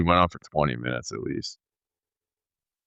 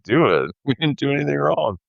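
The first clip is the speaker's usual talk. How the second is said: 웃음 섞인 도전적인 말투, 높게; laughing retort; 10 semitones higher, 3 dB louder than usual. Retold or imitated. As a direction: laugh through the defiant comeback, pitched up. Brian's retort, told laughing.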